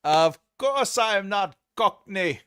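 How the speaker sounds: high, loud, slow put-on English; indignant hammed-up English denial; mock English accent. Streamer voicing a viewer denying being Cockney, mock English accent.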